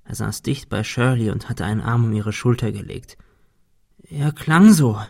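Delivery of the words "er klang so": stammering. A narrator's reading. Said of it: "stammelte sie".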